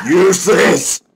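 high, very loud scolding; furious shout. Acted. Mistress berating her servant, furious shout. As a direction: explode at the servant.

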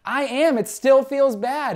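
exasperated inner voice; mock frustrated prayer. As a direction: plead like nothing's working. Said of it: "And you're like".

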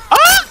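short, loud, very high yelp; startled scream. Real, not acted. Player yelping at the game, startled scream. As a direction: yelp in sudden shock.

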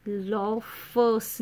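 "लोफर्स" drawn out slowly as "lo-fff-s"; exaggerated wrong plural. Shown as a demonstration. Teacher mocking up the mistake, slow "लोफर्स" with a separated f. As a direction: break "लोफर्स" apart, leaning on the f. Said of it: "नहीं बोलेंगे".

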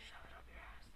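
hissed taunt, barely voiced; whisper. Real, not acted. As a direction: whisper the taunt so only he hears.

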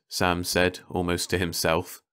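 ordinary delivery

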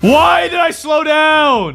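high, drawn-out wail of frustration; frustrated shout. Real, not acted. Player slowed down mid-chase, high frustrated shout. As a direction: cry out in exasperation, dragging the words.

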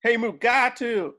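high, slow yell across the carpet; calling out. Acted. Protester yelling at Mugatu across the red carpet, high and drawn out. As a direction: shout his name to get his attention.

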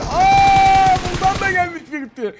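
high, loud sudden gasp of surprise; startled cry. Real, not acted. Gamer startled by a new enemy, loud surprised cry. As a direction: jump at the sight.

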